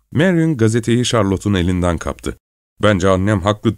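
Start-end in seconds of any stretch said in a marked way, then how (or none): none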